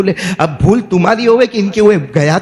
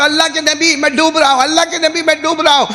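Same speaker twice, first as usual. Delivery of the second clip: high, raised cry for help; acted distress call; 10 semitones higher than usual. Drowning follower crying to the prophet, acted alarm. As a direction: plead urgently in a high preaching voice.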